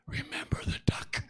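threatening whisper in his ear; little sister whispering a quiet threat. Acted. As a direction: breathe it into his ear as a warning. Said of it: "She whispers in his ear".

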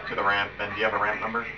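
ordinary talk, nothing marked

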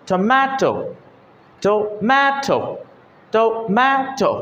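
টমেটো with a long "maay" in the middle; lengthened middle syllable. Shown as a demonstration. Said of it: "ম্যাটা একটু লম্বা হচ্ছে". (টমেটো-র মাঝের মে টেনে লম্বা করে)

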